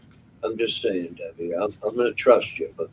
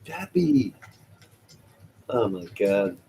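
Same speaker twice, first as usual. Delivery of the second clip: usual speaking voice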